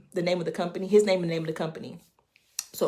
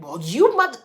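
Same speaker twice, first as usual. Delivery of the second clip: mock cuss-out; 5 semitones higher, 9 dB louder than usual. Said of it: "only a fool would be like".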